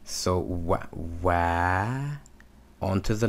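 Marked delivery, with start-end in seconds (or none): 0.4-2.2 "wa" sounded out softly, then held longer; slow teacher's "wa", repeated and lengthened; blending demo. Shown as a demonstration. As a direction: blend "w" with short ah, stretching the second "wa". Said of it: "patha makes a ah sound".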